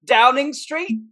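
high, faltering mock British accent; hesitant British bluffer; fake British accent. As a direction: stall for an answer in fake British. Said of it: "pretending to be British".

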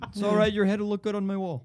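high-pitched mock Canadian voice; Canadian accent. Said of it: "I can't do that accent".

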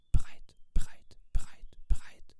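crisp, separate stage whispers; whispered replies. A narrator's reading. Friends answering owl one after another, whispering. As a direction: whisper each word in turn. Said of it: "flüsterten die anderen nacheinander zurück".

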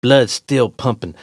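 ordinary delivery, nothing marked

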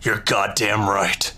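low, slow gravelly-free menacing drawl. Acted. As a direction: play it like a cold drug kingpin.

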